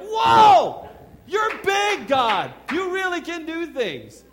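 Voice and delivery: high, loud shout of amazement; overjoyed shouting. Retold or imitated. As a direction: yell it to the sky in wonder. Phone call to kids, overjoyed.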